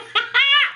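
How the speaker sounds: high, delighted squeal of laughter; giddy laugh. Real, not acted. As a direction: laugh out pure glee. After unboxing joy.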